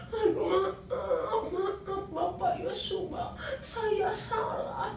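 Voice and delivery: high, slow apology with a nervous laugh; a sheepish sinner owning up; guilty apology. Acted. Achan apologizes to Joshua Bapak, high and nervous.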